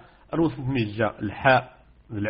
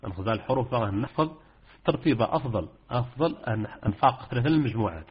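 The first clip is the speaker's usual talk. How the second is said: usual speaking voice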